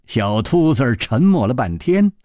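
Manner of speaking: plain reading voice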